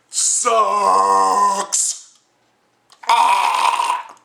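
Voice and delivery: loud high outburst; shouting. Real, not acted. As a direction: yell it out in frustration. Ranting outburst about kids.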